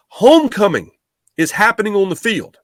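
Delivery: retold boss, pitched high and pushy; insistent boss. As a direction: hammer home what matters. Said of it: "He goes".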